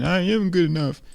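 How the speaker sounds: high, frustrated boxer insisting; insistent. Acted. Ruben keeps insisting he is good enough, high frustrated voice. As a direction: push it out high and emphatic. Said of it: "He kept saying".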